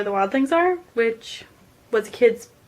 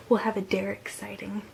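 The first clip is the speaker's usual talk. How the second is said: whispering; 9 dB quieter than usual. Real, not acted. Hushed aside.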